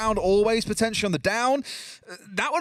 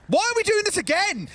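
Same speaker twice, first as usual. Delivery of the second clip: high-pitched, amused exclamation; laughing disbelief; 11 semitones higher than usual. Real, not acted. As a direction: cry out incredulously, breaking into a laugh. Caster in laughing disbelief at repeat play.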